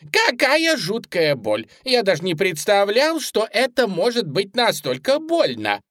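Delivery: high pained bunny voice; high, melodramatic suffering; agonised rabbit. A narrator's reading. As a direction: lament the pain in a squeaky voice. Bunny in agony.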